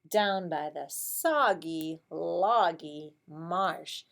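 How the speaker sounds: slow singsong storybook voice; reading to a toddler. Acted. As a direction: draw it out for little kids. Reading picture book to class.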